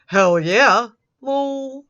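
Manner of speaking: loud, hammed-up whoop; mock excited shout. Reading the chat aloud, a loud mock cheer. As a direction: blurt it out like an eager kid.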